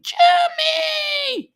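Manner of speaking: high, loud cry of a name across a distance; squeaky mock shout; calling out Danny. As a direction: holler his name like you're calling someone far away. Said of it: "And Danny's going".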